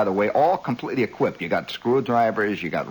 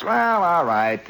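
slow reluctant junkman; 6 semitones higher, 3 dB louder, much slower than usual. Retold or imitated. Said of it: "He says".

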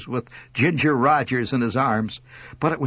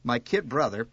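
plain reading voice

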